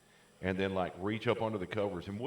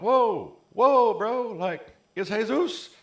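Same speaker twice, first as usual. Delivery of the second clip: loud high panicked whoa, slow on it's Jesus; startled shout; 13 semitones higher, 11 dB louder, much slower than usual. Retold or imitated. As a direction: yelp it like someone with a knife at his throat. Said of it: "and he was like".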